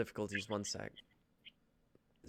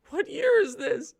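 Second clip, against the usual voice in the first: much higher, louder startled cry; shocked squeak; 8 dB louder than usual. Acted. Captive asking the host, shrieking in shock. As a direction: squeal it in disbelief. Actor overreacting in sketch.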